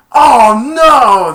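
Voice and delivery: high, very loud groan of dismay. Retold or imitated. Players reacting to the ruling, loud and high. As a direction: wail at the bad news. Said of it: "they're like".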